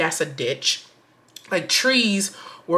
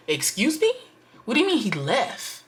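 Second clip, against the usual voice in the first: higher-pitched, incredulous retort; indignant disbelief; 5 semitones higher than usual. Retold or imitated. Storyteller replaying her retort to the cousin, indignant. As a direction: act out offended disbelief.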